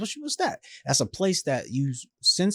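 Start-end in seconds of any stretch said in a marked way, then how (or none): 0.0-0.6 high, soft stunned question. Retold or imitated. Son hears mom is going to hospice, stunned. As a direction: say it as if hearing hospice for the first time.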